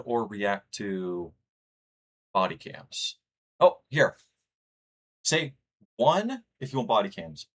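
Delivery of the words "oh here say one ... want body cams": higher and louder, jumping in eagerly; excited call. Real, not acted. Streamer rallying chat to vote, bright excited call. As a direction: grab the room's attention.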